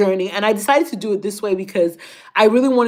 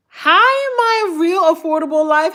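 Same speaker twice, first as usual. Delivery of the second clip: excited greeting; 7 semitones higher, 3 dB louder than usual. Real, not acted. Greeting viewer in live chat.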